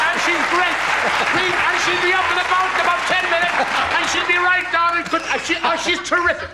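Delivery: high-pitched cheery prattle; hammed-up chirpy assurance; breezy reassurance. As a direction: gush good news while backing offstage. Prankster ad-libs good news onstage.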